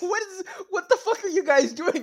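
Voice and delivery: building from laughing rant to a high yelling outburst; hammed-up rage at the Templars, rising to a yell; mock furious noble. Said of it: "It's like".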